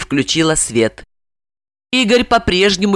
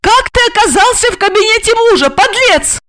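high, accusing female voice; raging interrogation; furious demand; 4 dB louder than usual. A narrator's reading. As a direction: hurl the accusation, pitched high. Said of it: "яростно требовала она".

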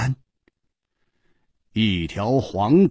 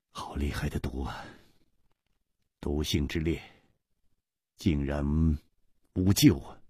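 low, soft, sorrowful lament; gloomily; 8 semitones lower, 8 dB quieter than usual. Acted. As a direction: mourn it quietly. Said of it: "黯然说". (楚留香黯然低声说)

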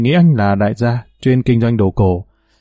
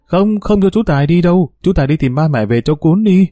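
put-on a little girl's higher, insistent protest; pleading child; 6 semitones higher than usual. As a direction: beg like a clinging small child. Little girl protests uncle leaving. (giọng bé gái nài nỉ, cao hơn)

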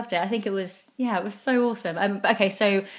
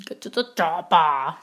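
Arnie impression; 3 semitones lower, slower than usual. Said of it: "like Arnie".

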